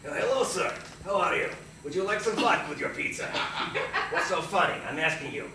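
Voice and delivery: put-on Russian accent, pushy delivery man offering vodka. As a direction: ham up a crazy Russian pizza man. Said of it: "a crazy Russian delivery guy".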